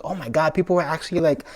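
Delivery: high-pitched, overwhelmed disbelief; getting emotional. Retold or imitated. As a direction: relive the amazed moment, voice lifting high. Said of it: "I'm getting emotional now".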